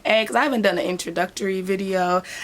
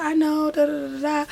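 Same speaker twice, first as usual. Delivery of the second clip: mock client whine; 8 semitones higher than usual. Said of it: "She's like".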